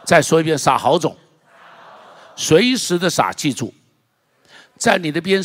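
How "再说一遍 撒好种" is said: loud rallying call; loud call to repeat. Real, not acted. Preacher rallying the church to repeat, loud. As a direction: lead the congregation loudly in the phrase. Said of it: "一起说撒好种".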